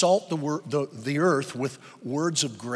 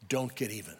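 dropped to a quiet, low, firm instruction; 5 semitones lower, 8 dB quieter than usual. Shown as a demonstration.

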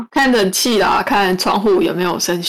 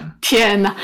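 ordinary talk

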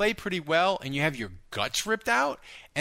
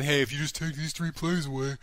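nerd voice. Acted. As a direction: play it like a know-it-all nerd. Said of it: "my nerd voice".

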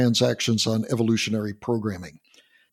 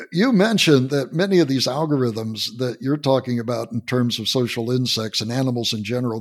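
usual speaking voice

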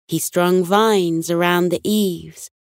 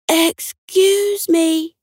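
higher, more insistent drawn-out call; calling a little louder; 11 semitones higher, 3 dB louder, much slower than usual. A narrator's reading. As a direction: stretch it out and pitch it up to be heard. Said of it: "a little louder".

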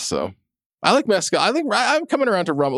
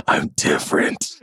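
whispered and low; breathy whisper; 11 semitones lower than usual. Pretend. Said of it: "whispery, breathy voice".

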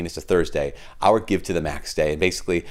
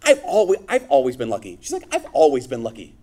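homily voice, higher and livelier than his chat; preaching a homily; 8 semitones higher than usual. Acted. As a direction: preach it to the congregation, lifting the voice.

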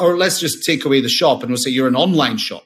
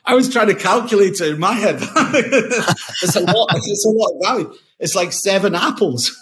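laughing; 6 semitones higher than usual. Real, not acted. Laughs at own maths joke.

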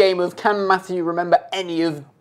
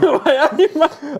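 laughing mock Geordie "why aye, man"; mock Geordie echo; 8 semitones higher than usual. Copying his co-host's joke in laughing mock Geordie. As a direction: echo it back in a jokey Geordie. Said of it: "I can't do George".

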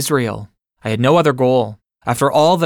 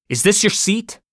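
sharp demand, pitched much higher; 9 semitones higher than usual. Retold or imitated. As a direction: throw the question at him, demanding. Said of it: "I demanded".

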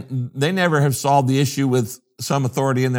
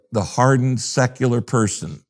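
ordinary delivery

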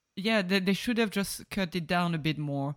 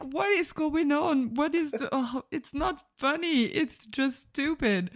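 higher, slower, in mock disbelief; exasperated disbelief; 8 semitones higher than usual. Podcaster retelling her reaction to the film, higher and disbelieving. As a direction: throw up your hands at a stupid scene, pitched up high. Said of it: "And I'm like".

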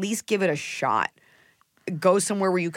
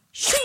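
sword shing; 3 dB louder than usual. Shown as a demonstration. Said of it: "I can't always shing".

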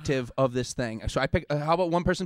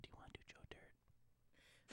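whispered aside; 36 dB quieter than usual. Real, not acted. Friend whispers a hint to a teammate, whispering. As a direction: whisper so the guesser can't hear.

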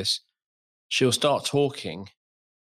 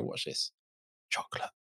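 hushed, voiceless aside; whisper; 12 dB quieter than usual. Retold or imitated. Father showing how nickname beats worries about hearing, whisper. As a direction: whisper it secretively across the room. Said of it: "whispering chocolate across this room".

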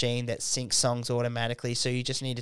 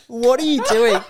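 laughing; 12 semitones higher, 12 dB louder than usual. Retold or imitated. Retelling club staff, laughing.

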